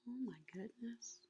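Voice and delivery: whispered oh my goodness; hushed aside. Real, not acted. Reader whispers her amazement to the children. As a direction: breathe it in mock shock.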